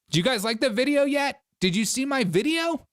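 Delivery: high-pitched, hopeful mock voice; eager TikToker. TikToker asking housemates about his video, mock-eager. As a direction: pitch it way up, fishing for approval.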